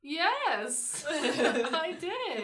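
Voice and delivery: high, laughing admission; giggling through the yes; laughing confession. Retold or imitated. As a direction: laugh it out, sheepish. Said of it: "I think it's so funny".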